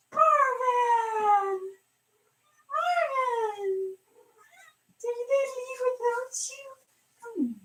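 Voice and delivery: calling the cat. Real, not acted. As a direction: coo for the cat from across the room.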